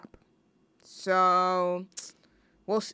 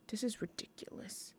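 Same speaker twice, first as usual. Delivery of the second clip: breathless whisper, much softer; whispered frustration; 15 dB quieter than usual. Real, not acted. Host muttering to herself while hunting for the song, whispered. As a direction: whisper it under your breath, exasperated.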